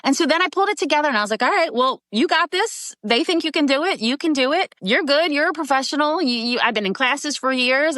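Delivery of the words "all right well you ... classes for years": higher-pitched, self-reassuring pep talk. Retold or imitated. Actress telling herself she can do it, acted pep talk. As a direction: psych yourself up, pitched high.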